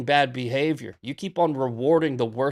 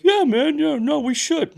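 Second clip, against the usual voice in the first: much higher, loud dopey agreeing voice; mocking chatter voice; 10 semitones higher, 4 dB louder than usual. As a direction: parody the chat as eager idiots. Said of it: "they're like".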